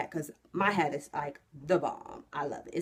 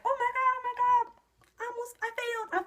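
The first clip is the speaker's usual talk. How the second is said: much higher, breathless panic on each oh my God; panicking; faster than usual. Retold or imitated. Driver panicking at the stoplight during her test, high and panicked. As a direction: squeak it out in sudden alarm. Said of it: "I'm panicking".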